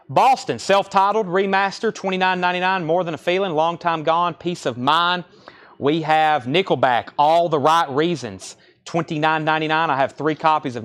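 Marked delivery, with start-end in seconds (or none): none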